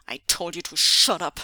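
loud, angry growl; forceful, menacing order. Acted. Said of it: "Zu She growled".

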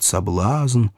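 usual speaking voice

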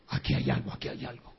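whispered, unvoiced and suspicious; hushed whisper. Jealous husband whispering his suspicion to himself. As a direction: breathe it like a secret suspicion.

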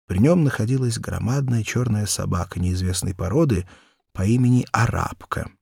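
plain reading voice

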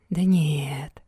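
low, soft drawn-out murmur; thoughtful drawl. Acted. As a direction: drawl it quietly, lost in thought. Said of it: "задумчиво протянул Максимилиан".

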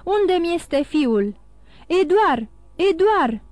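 loud, high alarmed calls; distraught shouting. A narrator's reading. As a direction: cry out, searching desperately. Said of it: "strigă el dintr-o dată".

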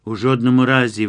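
emphatic outcry of refusal; exclaiming. A narrator's reading. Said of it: "вигукнув Богдан".

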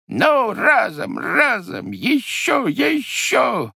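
high, effortful urging on; straining heave. A narrator's reading. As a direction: heave on each word, slow and straining. Heaving a heavy cauldron out.

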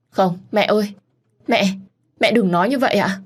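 high, pleading refusal; anguished protest. Acted. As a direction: protest to a dying mother. Refusing mother's last words.

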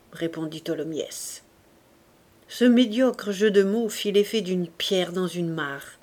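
usual speaking voice

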